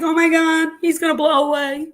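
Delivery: half-joking frightened wife; mock panic. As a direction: wail it like he's already gone. Retelling her panic.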